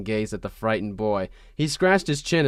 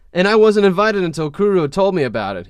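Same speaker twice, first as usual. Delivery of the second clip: teasing; 6 semitones higher, 5 dB louder than usual. Acted. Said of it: "he pounded teasingly".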